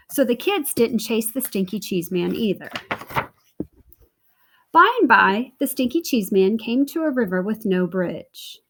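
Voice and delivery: usual speaking voice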